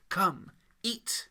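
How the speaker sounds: tempting villain. Acted. Lucifer tempts.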